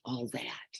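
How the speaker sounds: breathy hush on the words; whisper. Real, not acted. To the listeners, a hushed whisper. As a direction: let it fall to a whisper. Reflecting softly on grief.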